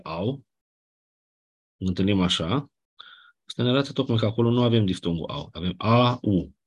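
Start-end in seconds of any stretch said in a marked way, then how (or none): 5.5-6.6 A-U said slow as two vowels, not a diphthong; A-U, two vowels said slowly; separate vowels. Shown as a demonstration. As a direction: pull A and U apart slowly. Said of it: "Nu pronunțăm au".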